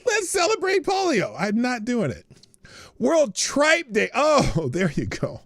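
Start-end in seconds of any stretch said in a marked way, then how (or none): 0.0-1.3 very high, hammed-up festive cheer; mock celebration. Host mocking the name World Polio Day, mock-celebratory. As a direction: parody someone thrilled to throw a party. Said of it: "they call it World Polio Day, like".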